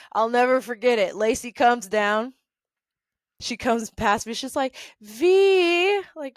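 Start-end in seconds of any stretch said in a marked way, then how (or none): 5.0-6.0 high, loud drawn-out call. Retold or imitated. Coworker hailing her friend in passing, calling out. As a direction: imitate her calling out a name, stretched and high.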